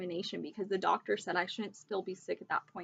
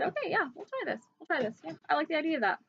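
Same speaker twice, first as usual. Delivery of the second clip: light, higher voice of an agreeable doctor; quoting the doctor; 8 semitones higher, faster than usual. Retold or imitated. Retelling how her doctor agreed, lightly voiced. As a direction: play the kind doctor saying yes, light and agreeable. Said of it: "and she said".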